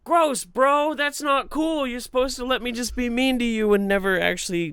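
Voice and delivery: high-pitched mock indignation; acted disgusted protest. Retold protest to the friend.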